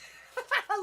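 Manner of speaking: sudden high laugh bursting out; burst of laughter. Real, not acted. Laughing at the card's smooth-talking line. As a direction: crack up at the card.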